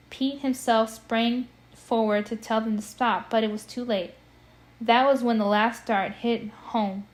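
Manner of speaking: ordinary delivery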